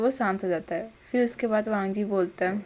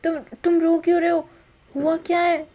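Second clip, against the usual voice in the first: worried asking; 6 semitones higher than usual. Retold or imitated. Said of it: "वांग जी डर जाता है".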